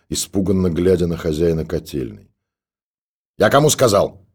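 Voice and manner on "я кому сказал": put-on high, loud bark at the girl; snapping shout. A narrator's reading. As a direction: raise your voice to make her move. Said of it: "прикрикнул на неё истопник". (истопник прикрикивает на девушку, громко и резко)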